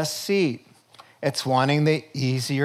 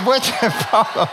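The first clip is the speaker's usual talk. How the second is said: high, breaking into laughter; laughing; 5 semitones higher than usual. Real, not acted. Preacher cracks up at his own steak joke. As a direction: laugh through the line.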